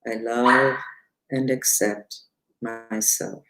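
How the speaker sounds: slow, soft mantra chant; mantra recitation. Shown as a demonstration. Teacher leading viewers through the mantra, slow soft chant. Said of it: "I'm gonna say it three times out loud".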